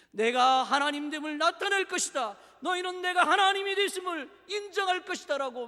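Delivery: loud, emphatic divine proclamation; God's declaration. Retold or imitated. God answering the complaining Israelites, loud declaration. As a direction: proclaim it forcefully, voice rising.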